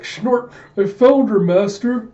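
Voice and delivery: high, loud snorting minion voice; excited henchman. Acted. Baznard reporting to his master, high loud minion voice. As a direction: squeal it up to your master.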